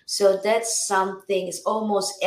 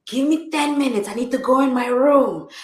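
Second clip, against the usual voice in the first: higher, louder burst of urgency; excited, pitched-up quote; retold urgent request; 6 semitones higher, 6 dB louder than usual. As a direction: re-enact asking for time as inspiration hits. Retells urgent request as lyrics came.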